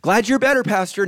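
bright fake welcome, keep your distance; high, loud, sugary false welcome; mock cheerful. Church member greeting the pastor with forced cheer.

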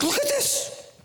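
a high, mocking whine; lightly voiced whiner; mock complainer. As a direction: poke fun at the complainer. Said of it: "You're like".

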